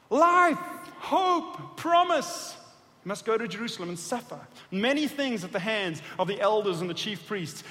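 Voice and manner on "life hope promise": high ringing words of hope; excited outcry. Acted. Preacher crying out Peter's hopes, high and ringing. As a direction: cry out each word louder.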